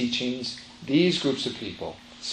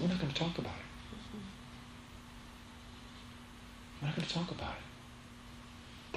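hushed secrecy; 9 semitones higher, 11 dB quieter than usual. Retold or imitated. A family keeping a tragedy unspoken, hushed. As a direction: voice dropped to a higher, soft hush.

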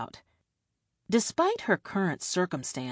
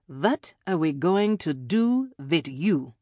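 put-on European-accented villain toying with a captive; captor's European accent with swooping pitch; hard-to-place European accent; 4 dB louder than usual. A narrator's reading. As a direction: vague European accent, theatrical villain question. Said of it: "a hard-to-place European accent".

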